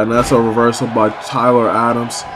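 ordinary delivery

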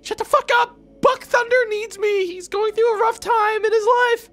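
frantic high yell. Real, not acted. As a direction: scream it out high and desperate. Yelling at game character.

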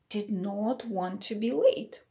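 usual speaking voice

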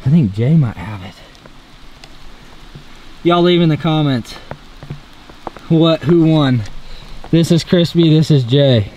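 usual speaking voice

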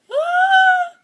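short high-pitched shriek; sudden loud scream of fright; frightened scream. Real, not acted. Player startled by the horror game, frightened shriek.

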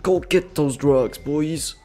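normal talking voice

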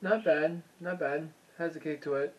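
chewing between words; eating while talking; mouth full of noodles. Real, not acted. Seen 0: Just slurped noodles; jaw works, bowl and chopsticks in hand.

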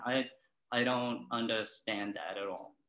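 usual speaking voice

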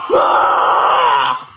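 startled shout. Acted. Attacked during family vacation.

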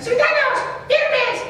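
Chabelo voice. Retold or imitated. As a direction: bark orders in a squeaky kid voice. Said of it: "Y te habla como Chabelo".

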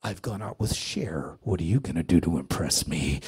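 very deep, haughty challenge; low, imposing voice of an unimpressed rock star; mock-arrogant impression, deliberately paced. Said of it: "he had this look about him like".

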